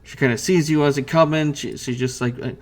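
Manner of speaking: normal talking voice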